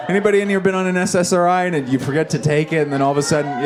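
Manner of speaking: high, loud and fast, rushing the question; excited rush. Real, not acted. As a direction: pile into the question at speed.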